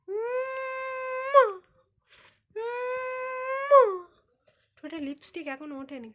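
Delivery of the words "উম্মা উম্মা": loud drawn-out kiss. Real, not acted. Mother showering her baby with kisses, high and drawn out.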